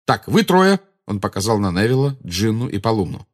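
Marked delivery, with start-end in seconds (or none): 0.0-0.8 loud, raised-pitch angry command; heated, bossy address; infuriated. A narrator's reading. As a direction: round on them sharply. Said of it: "снова разъярился Гарри".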